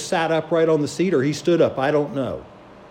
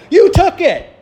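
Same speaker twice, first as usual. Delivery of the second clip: high, loud indignant cry; accusing shout; 13 semitones higher, 9 dB louder than usual. Retold or imitated. Girl shouting at the pilot holding her phone, high indignant yell. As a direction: blurt the accusation at full voice.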